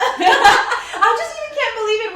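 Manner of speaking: high, loud, laughing; laughing excitedly. Real, not acted. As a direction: laugh through the words. Laughing excitedly about Netflix.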